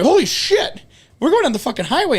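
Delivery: waking up to find the car drifting; retold alarmed shout. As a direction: blurt it out as you jolt awake. Waking up on the highway.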